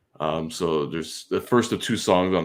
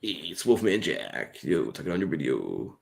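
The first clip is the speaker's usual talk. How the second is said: slow, lower, softer gravel DJ; Wolfman Jack; 3 semitones lower, 5 dB quieter than usual. Retold or imitated. Imitating Wolfman Jack on the radio, slow low DJ voice. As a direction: drag it out like a late-night radio DJ. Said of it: "Wolfman Jack came and said".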